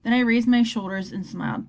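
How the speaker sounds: plain reading voice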